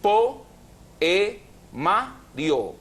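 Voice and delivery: "po, e, ma, rio" split with a pause after each part; "po" high and separate, stepping down to "rio"; syllable by syllable. Shown as a demonstration. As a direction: sound out "po, e, ma, rio" one syllable at a time. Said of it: "Poemario se divide en".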